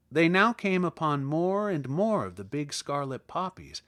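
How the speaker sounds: ordinary delivery, nothing marked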